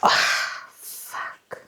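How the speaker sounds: choked whispered curse; tearful whispered oh fuck; emotional whisper. Real, not acted. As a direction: whisper it, trying not to cry. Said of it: "now I'm getting emotional".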